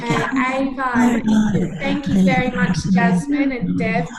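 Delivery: loud classroom teacher voice; kindergarten teacher voice. Acted. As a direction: call the whole class to attention. Teacher addressing class of children.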